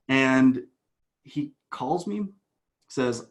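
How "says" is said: normal talking voice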